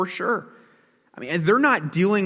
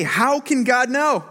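arrogant challenge; 8 semitones higher, 4 dB louder, slower than usual. Retold or imitated. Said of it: "Then they say".

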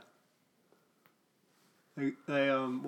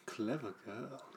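very soft, low echo of a movie line; hushed film quote; 3 semitones lower, 12 dB quieter, slower than usual. Retold or imitated. Echoing the co-host's Jurassic Park quote, hushed. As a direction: murmur it quietly.

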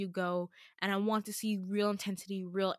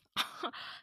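a quick unvoiced laugh; half laugh, half scoff; short breathy laugh; 8 dB quieter than usual. Real, not acted. Laughing mid-rant.

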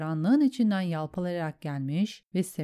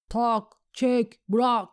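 raised, loud drill calls; giving commands; 5 semitones higher, 4 dB louder, much slower than usual. A narrator's reading. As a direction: call out each archery command. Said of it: "komutları verirken". (yüksek sesle komut verir gibi)

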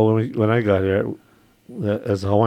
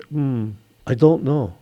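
plain answer; 3 semitones higher than usual. Retold or imitated. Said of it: "And I would say".

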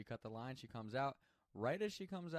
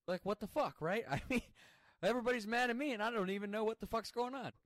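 loud, high-pitched exasperated rant; exasperated outburst; 11 semitones higher, 8 dB louder than usual. Retold or imitated. Recounting everybody being mad at him, loud exasperation. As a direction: vent your bewilderment, voice pushed up and loud.

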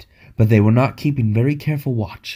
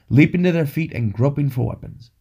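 ordinary delivery

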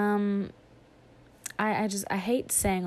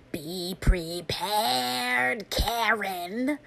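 Ray Liotta impression; 4 semitones higher, 4 dB louder, much slower than usual. Imitating Ray Liotta singing [name], very slow and raspy. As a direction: drag each word out like dust. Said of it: "It would sound a little bit like this".

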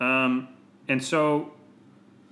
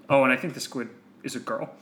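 ordinary talk

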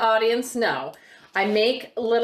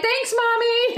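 mock kid voice; 13 semitones higher, slower than usual. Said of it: "They're like".

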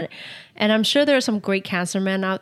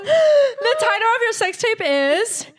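laughing, high and loud; 13 semitones higher, 4 dB louder than usual. Real, not acted. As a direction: crack up while saying it. Cracking up at own joke.

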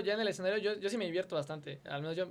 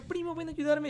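imitated call for help; 8 semitones higher than usual. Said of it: "empieza a gritar".

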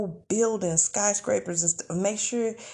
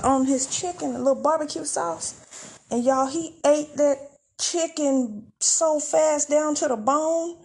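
ordinary talk, nothing marked

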